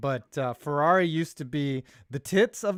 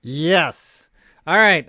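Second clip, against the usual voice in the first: normal talking voice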